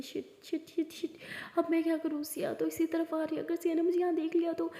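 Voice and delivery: frantic hushed whisper while hiding; panicked whisper. Acted. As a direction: whisper in panic so nobody hears.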